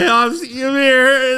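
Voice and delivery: high, loud blubbering, drawn out; hammed-up weepy plea; tearful pleading. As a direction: wail it as if reaching for a hug. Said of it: "trying to give the guy a hug".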